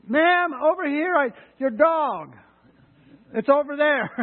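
slow, loud, high call. Retold or imitated. Jogger calling slowly across the lake to the owner, loud. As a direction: stretch the words out across the lake.

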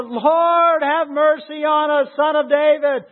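high, loud, insistent pleading shout. A narrator's reading. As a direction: read it as a full-voiced cry for mercy. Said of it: "they cried out all the more".

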